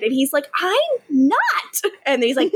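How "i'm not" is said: high-pitched mock protest; indignant denial. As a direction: deny it with the voice jumping up. Acting out Stefan's indignant denial.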